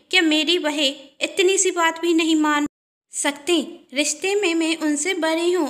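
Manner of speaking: aggrieved complaint. Acted. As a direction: play the hurt elder sister-in-law stirring trouble.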